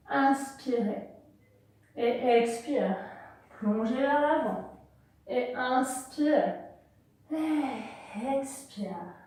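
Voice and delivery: slow, soft breath cues stretched out; guided breathing. Acted. Yoga teacher guiding the class through slow breaths, soft and drawn out. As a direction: draw each breath word out slowly and gently.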